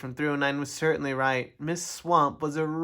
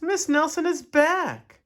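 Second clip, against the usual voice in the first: very high, loud, drawn-out cheer; excited class; 14 semitones higher, 7 dB louder than usual. A narrator's reading. Class announcing their teacher's return, excited. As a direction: stretch it out in a high pupils' voice.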